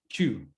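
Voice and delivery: two with a near ch sound; two said almost like chu; English two. Shown as a demonstration. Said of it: "Es como si fuera una C H". (two casi con CH)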